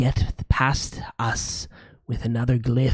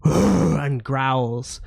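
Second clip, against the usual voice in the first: ordinary talk, nothing marked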